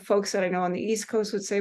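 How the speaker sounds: normal talking voice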